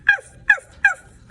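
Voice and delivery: high, loud puppy yaps; dog barking. Acted. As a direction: yap like an excited puppy. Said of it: "called Doggy".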